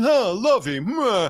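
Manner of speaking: a hint of the Gilligan's Island rich couple in a Greenwich accent; Howells old money accent. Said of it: "talk like the Howells".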